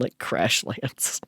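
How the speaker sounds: usual speaking voice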